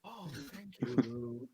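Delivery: slow whispered thanks; drawn-out whispered thanks. Acted. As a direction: whisper it slowly, pleased.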